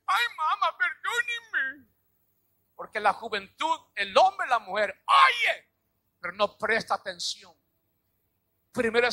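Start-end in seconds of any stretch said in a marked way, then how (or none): 0.0-1.9 high, wailing plea, the last word slow; crying like a child. Retold or imitated. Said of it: "Ahí viene llorando como niño". 4.0-5.7 preaching shout. Real, not acted.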